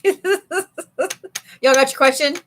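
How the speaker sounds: slow, laughing through the question. Real, not acted. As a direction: stretch it out while cracking up. Repeats question while laughing.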